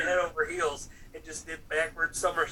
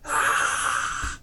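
loud, harsh burst of "ah"; mask scare yell; 8 dB louder than usual. Retold or imitated. Husband bursting in masked to scare his wife, mock attack yell. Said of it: "I come busting through the front door like".

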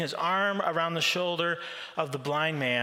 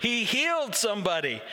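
higher, laughing disbelief; mock the obvious with a laugh; incredulous scoff; 6 semitones higher than usual. The crowd scoffing at the doubters, laughing and higher. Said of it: "others are like".

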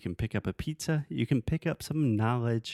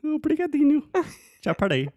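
1 playful mock Portuguese; 10 semitones higher, 7 dB louder than usual. Playful Portuguese accent exchange.

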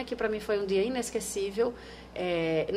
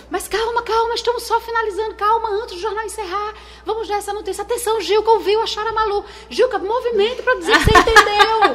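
much higher, louder urgent anchor voice; excited announcing; 11 semitones higher, 10 dB louder than usual. Retold or imitated. Anchor breaking the found-child news live, urgent and excited. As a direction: announce the big news while holding it together.

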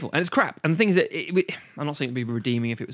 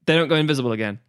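usual speaking voice

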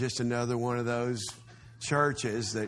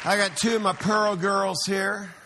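high and loud announcement; proud dad shouting to the crowd; excited shout; 7 semitones higher, 6 dB louder than usual. Real, not acted. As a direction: call it out proudly. Introducing his daughters.

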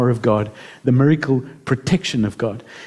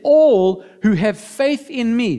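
slow, higher, solemn quote; quoting Jesus; 7 semitones higher, much slower than usual. Retold or imitated. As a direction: stretch "All" out slowly. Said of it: "Jesus said".

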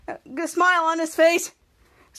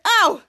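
high, loud yelp; cartoon ouch; mock ow; 5 semitones higher, 7 dB louder than usual. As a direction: yelp like you bumped the force field. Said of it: "and he got hurt".

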